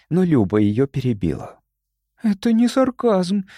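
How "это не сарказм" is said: high, excited exclamation; agitated. A narrator's reading. Люба interrupting to defend the theory, agitated. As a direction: burst in, voice pitched up. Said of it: "взволнованно воскликнула она".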